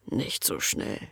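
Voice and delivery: breathed softly into the ear, slow; a low, slow breath close to her ear; right in your ear. A narrator's reading. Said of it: "hauchte er ihr ins Ohr". (ins Ohr gehaucht)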